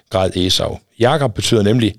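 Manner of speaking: ordinary delivery, nothing marked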